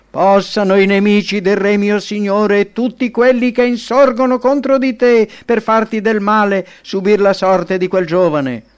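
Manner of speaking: high, loud declaimed verdict; messenger's grave proclamation. A narrator's reading. Messenger reveals grave news to king. (messaggero che proclama a voce alta e grave notizia)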